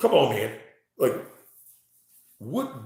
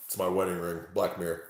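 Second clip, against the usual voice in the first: ordinary talk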